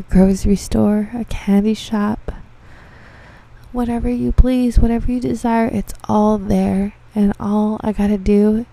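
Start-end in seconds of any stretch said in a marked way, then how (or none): none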